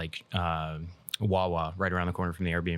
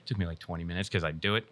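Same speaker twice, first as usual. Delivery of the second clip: ordinary delivery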